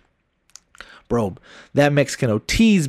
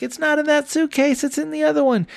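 high, lightly put-on woman's voice; a high imitation of the girlfriend replying; 13 semitones higher than usual. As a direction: mimic her answering back from the other room. Girlfriend voiced in retold story.

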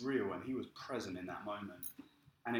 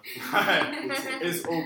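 laughing; 6 semitones higher, 10 dB louder, much slower than usual. Retold or imitated. Friend laughing at his conversion.

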